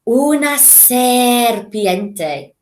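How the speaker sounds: slow, hissing snake delivery; slow serpent voice. Shown as a demonstration. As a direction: stretch the s like a snake. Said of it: "forked tongue".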